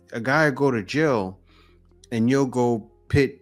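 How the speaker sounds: usual speaking voice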